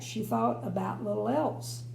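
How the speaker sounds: ordinary delivery, nothing marked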